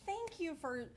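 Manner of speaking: hammed-up sarcastic thanks; dramatic sarcasm. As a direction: play up the wounded indignation, as an imagined retort. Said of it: "says I'm really dramatic".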